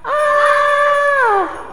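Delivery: high shouted ah; loud ah. Real, not acted. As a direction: shout ah together. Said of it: "can you say that really loud".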